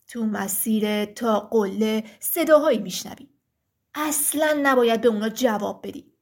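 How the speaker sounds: low, heavy giant voice; thick-voiced stone giant. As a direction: speak as a solemn rock giant. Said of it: "غول سنگی با صدای کلفت گفت". (صدای کلفت و بم غول سنگی)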